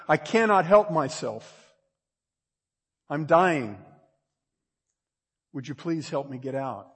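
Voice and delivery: loud, high, pleading cry for help; humbled desperate plea. Acted. Starving caver begs the voice for help, loud and desperate. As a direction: beg like someone starving in the dark. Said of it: "you will probably say".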